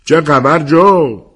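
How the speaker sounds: loud, drawn-out American greeting; American accent. A narrator's reading. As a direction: call out high and slow in an American accent. (با لهجهٔ آمریکایی، بلند و کشیده)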